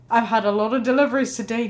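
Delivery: hint of a worn-out, irritated driver; annoyed delivery driver. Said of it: "saying things like".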